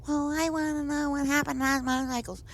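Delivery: high, mocking imitation of a demanding woman; mocking whiny demand. Teller mimics Pat insisting about the motorcycles, high mockery.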